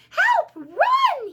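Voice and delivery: high, loud shouts of alarm; panicked cry. A narrator's reading. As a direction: yell for everyone to run. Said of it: "he cried".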